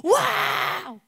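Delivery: high, drawn-out crowd roar; mock battle cheer. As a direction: imitate an army roaring as one. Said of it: "얼마나 소리를 질렀던지". (군대의 함성을 높게 흉내)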